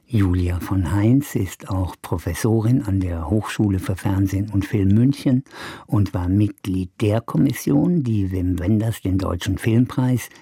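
normal talking voice

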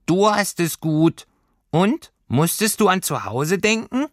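high, tiny creature voice; a small homesickness sprite speaking up; little Heimweh voice. A narrator's reading. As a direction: homesickness creature answering Lilly from above. Heimweh questions Lilly.

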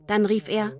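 usual speaking voice

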